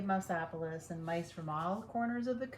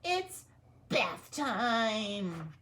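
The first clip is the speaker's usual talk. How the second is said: higher, louder mouse challenge; squeaky mouse; 3 semitones higher, 7 dB louder, much slower than usual. Acted. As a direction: taunt the dog like a brave mouse. Big Cheese confronts the dog.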